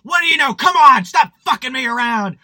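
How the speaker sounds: detective yelling at a suspect during interrogation, furious; angry interrogation. Retold or imitated. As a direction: bang the desk and demand answers. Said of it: "banging on the desk and being like".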